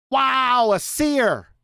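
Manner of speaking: hammed-up fake awe; mock amazement. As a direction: pretend to be dazzled by the obvious. Sarcastic awe at obvious prediction.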